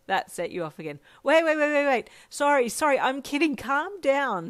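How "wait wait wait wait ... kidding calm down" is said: loud, high rush of apology; frantic backpedalling. A narrator's reading. Friend taking back a tease amid struggles, loud and frantic. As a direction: blurt it urgently, pitched up.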